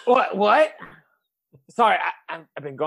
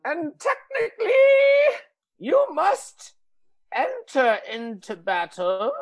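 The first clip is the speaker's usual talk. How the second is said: put-on dramatic old master; 7 semitones higher, 7 dB louder, slower than usual. Master urges hero to battle.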